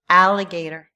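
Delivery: teacher's clear "Alligator"; model word. Shown as a demonstration. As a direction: sound out "Alligator" for repeating. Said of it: "Can you say them with me".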